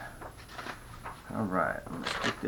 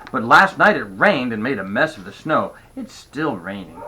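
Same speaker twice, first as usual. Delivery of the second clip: usual speaking voice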